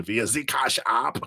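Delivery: lightly put-on German accent. Reader thanking Michelle for donation, joking German accent. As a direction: slip into a mock German accent. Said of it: "That's my German impression".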